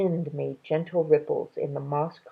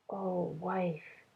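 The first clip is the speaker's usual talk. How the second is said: much softer, tender murmur; softly; 11 dB quieter than usual. A narrator's reading. Ba comforting his crying wife, very softly. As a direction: comfort her gently. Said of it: "Ba said softly".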